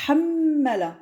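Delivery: hammala drawn out, the doubled m held; slow Arabic word. Shown as a demonstration. Teacher slowly models hammala for the class. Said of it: "troisième mot".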